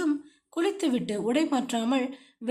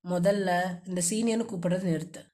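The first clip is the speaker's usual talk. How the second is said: lower, quicker, mildly irritated man; slightly angry; 6 semitones lower, faster than usual. A narrator's reading. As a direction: snap it out with a little anger. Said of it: "சற்றே கோபமாக சொன்னவன்". (சற்றே கோபமான, வேகமான ஆண் குரல்)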